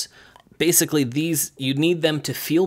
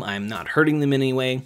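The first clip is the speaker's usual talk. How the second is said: ordinary talk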